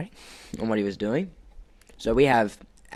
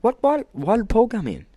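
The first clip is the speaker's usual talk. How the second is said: high, louder retold disbelief; baffled reaction; 14 semitones higher, 5 dB louder than usual. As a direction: pitch it way up in acted bewilderment. Retelling his own baffled reaction.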